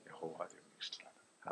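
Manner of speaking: whispered answer from the pulpit; hushed whisper. Real, not acted. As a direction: drop to a low whisper. (గుసగుసగా, చాలా మెల్లగా)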